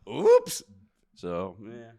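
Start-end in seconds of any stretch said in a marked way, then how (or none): 0.1-0.6 very high, loud squeak; squeaky oops. Acted. Self-mocking exclamation.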